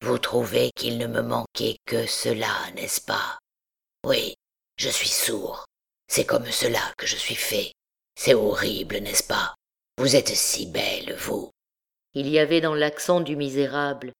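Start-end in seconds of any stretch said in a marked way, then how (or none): 0.0-11.5 low, whispered, bitter self-mockery; Quasimodo, painful smile. A narrator's reading. Hunchback mocking his own ugliness before the beauty, low whisper. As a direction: smile through the hurt, hushed. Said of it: "Il se mit à sourire douloureusement".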